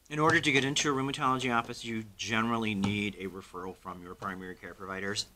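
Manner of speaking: ordinary talk, nothing marked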